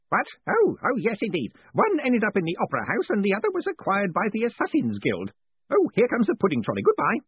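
put-on high, lively old wizard from a jar; a cheery old man distracted by dinner; sprightly elderly voice. A narrator's reading. Dead wizard answers from the jar, sprightly and elderly. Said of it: "a sprightly, if elderly voice".

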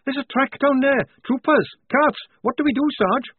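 high, urgent report; urgent alarm. A narrator's reading. Lofty reporting troopers to the sergeant, urgent alarm. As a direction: blurt it out high and panicky.

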